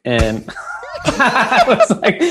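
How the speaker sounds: laughing through the words; cracking up mid sentence. Real, not acted.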